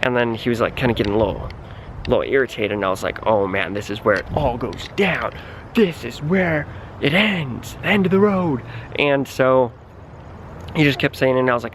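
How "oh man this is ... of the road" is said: mock doom. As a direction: say it like the final scene of a movie. Narrator's panicked inner thought.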